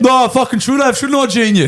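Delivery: mock-angry sarcastic comeback, pitched high; flying off the handle. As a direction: lash out with hammed-up sarcasm. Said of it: "I just fly off the handle".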